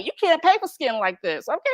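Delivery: ordinary delivery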